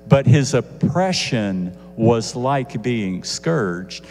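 normal talking voice

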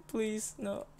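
normal talking voice

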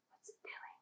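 unvoiced secret whisper. Teacher asking Lulu in a whisper. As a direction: whisper so the gas won't hear.

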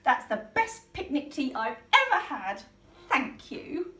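a touch of bright joy; happy Polly. Acted. Polly to her friends, lightly happy. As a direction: glad and thankful. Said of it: "said Polly".

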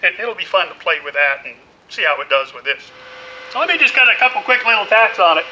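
ordinary delivery

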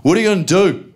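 mocking son challenging his father; cheeky teen defiance. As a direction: goad the dad, cocky. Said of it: "looks at his dad and goes".

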